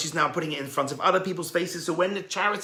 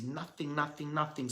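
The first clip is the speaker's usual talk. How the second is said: much softer repeated "nothing"; hushed emphasis; 9 dB quieter than usual. A narrator's reading. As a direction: let each nothing drop away softly. Miser gives nothing.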